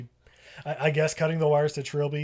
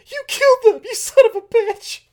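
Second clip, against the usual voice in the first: much higher, much louder screaming; shrieking accusation; 11 dB louder than usual. Retold or imitated. Claire finding the corpses screams at the captain. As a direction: scream it at the killer.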